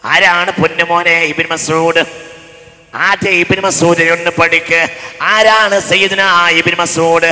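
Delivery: sermon with slow chanted Arabic; preaching at full force. Real, not acted. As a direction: rise to a ringing proclamation.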